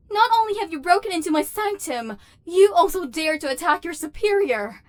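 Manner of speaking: shocked, loud affronted scolding; furious outrage. Acted. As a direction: rage at the insolent human. Knocked down, berating intruder.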